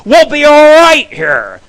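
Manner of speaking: a drawn-out retold yell at the girlfriend; mock yell. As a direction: bellow it like a dare. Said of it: "I'm like".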